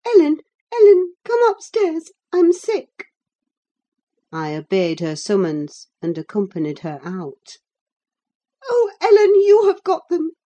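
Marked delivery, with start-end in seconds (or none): 0.0-3.1 put-on high, urgent distressed girl; gasped plea. A narrator's reading. Girl summoning Ellen upstairs, gasped plea. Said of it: "she gasped". 8.7-10.3 put-on high, loud girlish plea; distressed pleading. A narrator's reading. Girl on her knees to Ellen, pleading.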